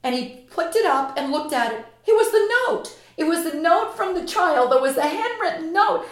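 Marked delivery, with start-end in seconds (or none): 2.1-6.1 high, loud, thrilled retelling of the moment; rising excitement. Retold or imitated. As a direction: let the discovery lift your voice. Emotional climax of the story.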